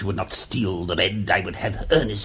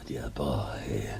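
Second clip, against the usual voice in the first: 1 weary whisper; 11 semitones lower, 10 dB quieter than usual. Acted. Weary sigh at blunder.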